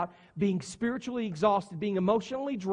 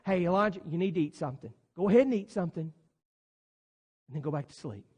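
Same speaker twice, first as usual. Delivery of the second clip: soft, low, fading to near silence; an angel softly nudging tired Elijah; gentle angel; 7 semitones lower, 5 dB quieter, faster than usual. Acted. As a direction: coax him tenderly to eat.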